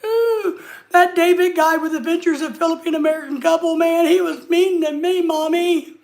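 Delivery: mock crying troll. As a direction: ham up a crybaby troll whining to mommy. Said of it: "going back to the basement crying".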